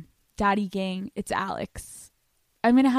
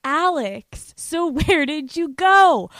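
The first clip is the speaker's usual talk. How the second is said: imitating co-host; 6 semitones higher, 5 dB louder, slower than usual. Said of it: "she would say".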